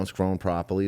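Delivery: normal talking voice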